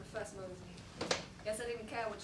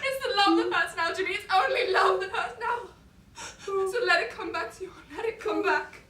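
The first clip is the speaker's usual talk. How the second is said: loud, higher, crying through it; tearful; 7 semitones higher, 10 dB louder than usual. Acted. Father telling Jimmy grief is love, tearful and raised. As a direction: push through tears.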